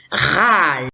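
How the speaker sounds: râle drawn out as one blended syllable; syllabe en entier. Shown as a demonstration. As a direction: stretch each sound of râle without a break. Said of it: "je lis la syllabe en entier". (râle lu en étirant les sons liés)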